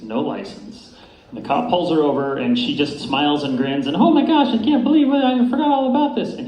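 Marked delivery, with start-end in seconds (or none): 4.0-6.3 much higher and louder, a gushing feigned innocence; high, bright-eyed mock innocence, rushing the last line; flirty wife. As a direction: gush like a cute, flirty wife caught out. Wife acting innocent to cop.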